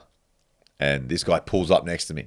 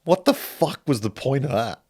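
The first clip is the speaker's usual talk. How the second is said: incredulous; 9 semitones higher than usual. Retold or imitated. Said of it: "And I was like".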